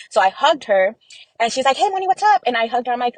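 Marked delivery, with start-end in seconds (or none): 1.8-2.4 high-pitched casual hello; retold cheery greeting. Sister greeting her in the dream, high breezy hello.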